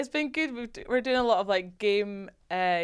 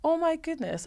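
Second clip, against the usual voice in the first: high, hushed wonder; retold amazement; 10 semitones higher, 3 dB quieter than usual. Player recalling first seeing women's rugby on TV, amazed. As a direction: re-enact being amazed, pitched up high.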